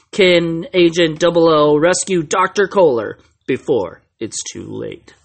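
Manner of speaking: booming, punchy movie-trailer announcer; big dramatic trailer read. Pretend. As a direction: read the synopsis like a hammy trailer voiceover, dropping away at the end. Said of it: "do it in my movie voice".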